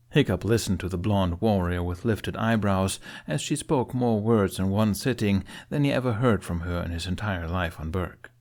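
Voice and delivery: plain reading voice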